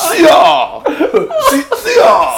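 high, loud mock villain cry; villain war cry. Co-hosts trade a villain's catchphrase, hammed-up war cry.

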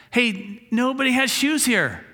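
a hint of a salesman's delight; excited discovery. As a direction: blurt it out like a big discovery. Salesman amazed at shoeless town.

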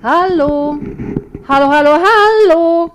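loud, high calls down into a dark pit; hollering hello into the depths; calling down a hole. A narrator's reading. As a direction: shout down and listen for an echo. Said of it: "ruft in das finstere Loch hinab".

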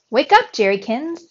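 loud wake-up shout. A narrator's reading. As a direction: call out loudly to wake him. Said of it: "Thea shouted in my ear".

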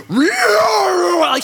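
high drawn-out mock demonic screech; imitated demon voice. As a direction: imitate a possessed wail, loud and high. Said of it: "different voice coming at her".

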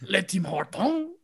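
"Le Tim Hortons" slow, with a French "Hortons" rising; slow mock French "Le Tim Hortons"; mock French echo. Echoing the French name.

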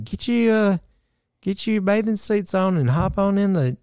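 mother-in-law inviting them into the hot tub, high mocking voice; imitating her mom. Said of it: "you know, she talk like this".

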